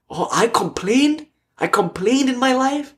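high, slow disbelieving rise; astonished self-question, pitched high and drawn out; incredulous. Retold or imitated. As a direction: ask yourself in shocked disbelief.

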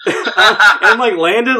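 high, laughing through the words; real amusement breaking into the telling; laughing while telling. Real, not acted. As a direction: crack up mid-story. Laughing at her own story.

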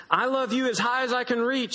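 put-on high, childlike bunny voice; tiny hare boasting up high; little hare voice. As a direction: sound small and eager, reaching up. Little hare speaks, then big.

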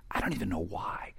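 hushed aside to wife; soft whispered confession. Retold or imitated. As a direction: whisper it so people around can't hear. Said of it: "I just whispered to her".

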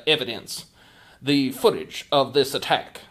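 normal talking voice

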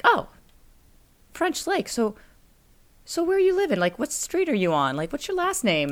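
a hint of a cheerful small-town cashier asking questions; friendly store clerk.

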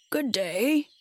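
lightly husky Scarecrow greeting; a hint of huskiness in a slow-witted straw man. A narrator's reading. Scarecrow greets the girl at the pole, lightly husky. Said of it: "in a rather husky voice".

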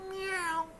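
high, soft imitation meow; mock kitty mew; cat meow. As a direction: answer like a cat, high-pitched. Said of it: "meowing like a cat".

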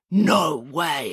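put-on children's drawn-out disbelief; awed disbelief; amazed exclamation. A narrator's reading. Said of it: "exclaimed the children".

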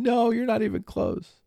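put-on high, gleeful child voice; voicing his teasing son. Father imitating his son laughing at his wrong guess. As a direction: tease dad like a kid.

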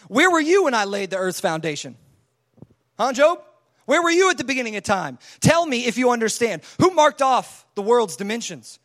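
loud, insistent cross-examination; God going hard. Acted. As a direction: grill Job with forceful questions. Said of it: "he just goes hard on him".

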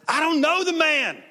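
loud, high-pitched outburst of denial; emphatic denial. Acted. Peter denying Jesus when asked, emphatic denial. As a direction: shout it with full emphasis. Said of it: "with emphasis".

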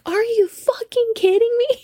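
very high, squealed disbelief; incredulous outburst. Retold or imitated. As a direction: imitate stunned disbelief, about to crack up. Friend's retold disbelief, breaking into laughter.

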